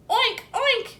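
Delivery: high oinking pig; pig oinks. A narrator's reading. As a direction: oink it out like a pig. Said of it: "he shouted at the howling wind".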